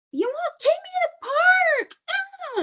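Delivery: put-on high whining kid voice; whiny child. Mom imitating a child denied the park, whining. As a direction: play the upset child begging to go.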